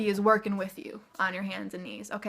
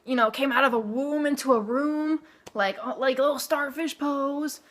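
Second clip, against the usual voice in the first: newborn's imagined voice; 4 semitones higher, 3 dB louder than usual. Retold or imitated. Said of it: "he's like".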